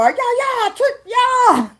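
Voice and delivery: very high, loud yell; guard-up shout. Acted. Voicing someone standing on guard, high loud shout. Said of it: "when I seen them stand and go".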